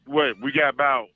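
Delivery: normal talking voice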